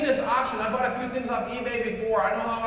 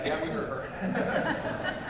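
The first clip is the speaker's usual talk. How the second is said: slow, laughing through the words; laughing mock reply; much slower than usual. Speaker voicing the audience, slow and laughing. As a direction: mimic the crowd while chuckling.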